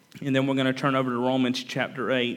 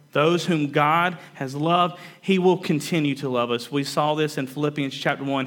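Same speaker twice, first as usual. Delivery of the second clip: ordinary talk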